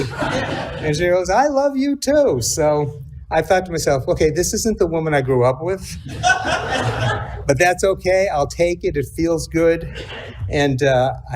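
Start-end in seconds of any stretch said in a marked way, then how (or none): 1.3-2.4 high, sing-song imitation of mom; much higher, lilting elderly mother; mother's sweet reply. Mother repeats same answer. 4.1-9.9 amused inner thought. Real, not acted. Bemused inner thought, laughing.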